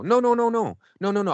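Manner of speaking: high, rapid-fire no's; retold flustered refusal; urgent protest. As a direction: stop someone in a hurry, voice jumping up. Retold stopping cashier opening bottle.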